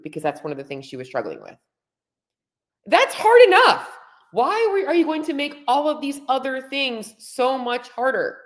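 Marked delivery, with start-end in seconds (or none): 2.9-8.4 high, loud exasperation; exasperated. Real, not acted. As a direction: throw up your hands at it. Exasperated rant about extremes.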